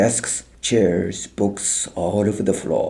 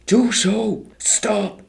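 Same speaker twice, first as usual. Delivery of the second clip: very high yell to stop them; 13 semitones higher, slower than usual. A narrator's reading. Helen yells at the soldiers to stop. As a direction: yell with everything you have. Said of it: "Helen yelled out as loud as she could".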